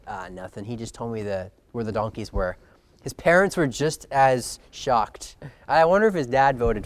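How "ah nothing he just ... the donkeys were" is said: evasive mumble. Retold or imitated. Saul dodging his parents' question.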